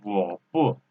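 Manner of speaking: 我 low without rising, then 不; half third tone. Shown as a demonstration. Teacher explaining the tone change, 我 in half third tone before 不. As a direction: keep 我 low straight into 不. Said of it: "it's half third tone".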